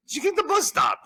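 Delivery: amazed retelling; incredulous. Real, not acted. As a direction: marvel at how absurd it was. Retelling outrageous moment.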